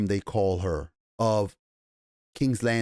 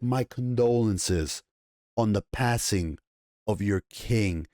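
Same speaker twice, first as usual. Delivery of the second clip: slow, pointed; 3 semitones lower than usual. Retold or imitated. The mysterious lady to Otto, slowly and pointedly. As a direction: let each word sink in. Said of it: "They made it like".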